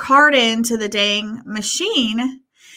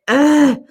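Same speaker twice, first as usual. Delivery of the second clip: annoyed groan; 5 semitones higher, 6 dB louder than usual. Retold or imitated. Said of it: "and I'm just like".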